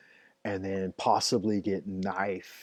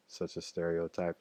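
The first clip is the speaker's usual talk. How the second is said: ordinary delivery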